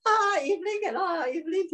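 high, laughing, teasing voice; real amused laughter in the words; laughing tease. Real, not acted. Teasing a listener amid laughter.

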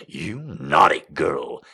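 low mutter. Acted. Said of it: "he muttered".

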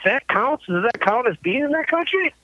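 high-pitched baffled questioning; mock incredulous, pitched well up; retold disbelief. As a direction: imitate a friend who can't believe the rule. Drummer's disbelief at customs, retold.

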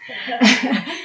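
a quick rising laugh; short real laugh. Real, not acted. Host laughs at guest's guilt remark, quick rising laugh. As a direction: laugh at the joke.

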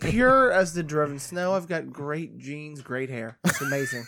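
mock boast. Boasting about his DNA.

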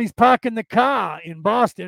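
put-on Boston accent, "car" with no r at the end. Mimicking a Boston cousin parking the car, Boston accent. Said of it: "your cousin from Boston".

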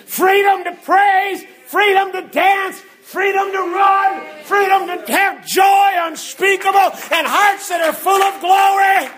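high, driving, chanted-style list; climactic preaching. Acted. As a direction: hammer each phrase to the congregation at full force. Preaches at full force.

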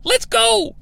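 high-pitched, clipped "Let's go,"; mock Russo. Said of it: "After he says".

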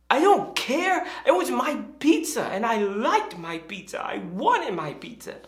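high, stubborn mock-kid whine; petulant little girl. Younger daughter answering her sister, mock petulant outburst.

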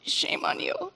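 high, breathy mock whisper of shame; a mom hearing the teacher's unspoken judgment as a high breathy whisper; imagined scolding. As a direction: hiss it like a scornful inner voice. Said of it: "And I was like".

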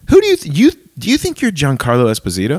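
a scoffing, disbelieving challenge; mock incredulous. Reacting to a bold prayer, mock outraged disbelief. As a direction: pitch jumps way up on "who", then settles. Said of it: "is like".

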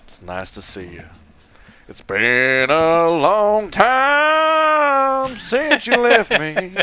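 slow crooning country ballad voice; [name]. Pretend. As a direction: croon it like a lovesick country singer. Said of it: "[name]".

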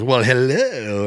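slow, sly Grinch greeting; slow Grinch hello. Retold or imitated. Jim Carrey greets the boy with a sly Grinch smile. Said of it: "He goes".

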